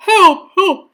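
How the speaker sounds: high, loud call for help; urgent radio call. A narrator's reading. Policeman radioing the firemen, urgent loud call. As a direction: policeman raising the alarm.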